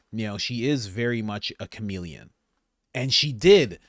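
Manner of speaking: usual speaking voice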